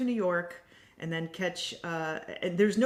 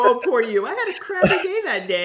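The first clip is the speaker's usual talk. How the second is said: high, loud whiny pity-me voice with a laugh; mock self-pity; 6 semitones higher, 12 dB louder than usual. Guest to retreat staff, wishing for pity, loud whiny and laughing. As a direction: ham up wanting to be coddled. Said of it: "I wanted them to go".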